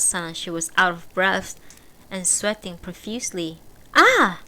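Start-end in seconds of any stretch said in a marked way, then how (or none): 3.9-4.4 high, loud cry of recognition; sudden startled ah; surprised gasp. A narrator's reading. Kay recognizes woman.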